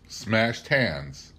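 plain reading voice

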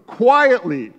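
quietly stressed and falling; correcting the crowd by stressing Quietly; emphatic quietly. Shown as a demonstration. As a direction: land hard on Quietly. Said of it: "he says".

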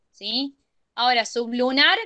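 normal talking voice

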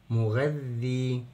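مغذي slowly, held double ذ into ee; shadda with kasra. Shown as a demonstration. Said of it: "تبقى شدة ونحط الكسرة". (مغذي ببطء بشدة وكسر على الذال)

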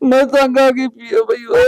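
put-on high, loud, slow drunken crying wail. Drunk younger brother wails to his older brother, crying loudly. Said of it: "ਰੋਣਾ ਕਾਹਤੋਂ ਆ?".

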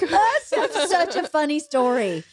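high, wheezing laugh into the words; helpless laughing. Real, not acted. Storyteller delighted by her own courtroom story, laughing hard. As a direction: talk through tears of real laughter.

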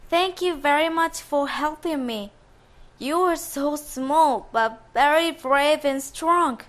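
put-on gentle young lady. Daughter's voice.

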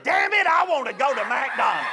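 mock kid's defiant outburst; sudden outburst. Son cursing at father after being refused, sudden outburst. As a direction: burst out with an imitated demand. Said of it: "all of a sudden, out of my mouth".

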